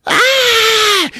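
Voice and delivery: very high, loud hammed-up scream; mock scream. Stabbed girlfriend screaming, hammed-up scream. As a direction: shriek as stabbed.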